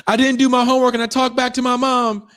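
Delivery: put-on very high, loud, fast little-kid voice; kid confessing. Kid tells the prisoners his crime, high and fast. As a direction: blurt it out high like a small child.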